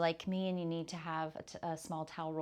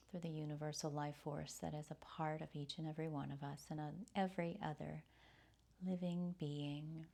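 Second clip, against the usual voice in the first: soft, soothing breath-led meditation voice; guided meditation; 2 semitones lower, 8 dB quieter than usual. Real, not acted. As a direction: softly guide them through the breath, with long pauses. Reflects on the chant.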